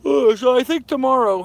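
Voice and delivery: yawning through the words. Real, not acted. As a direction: talk through a yawn. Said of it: "I'm sorry I'm yawning".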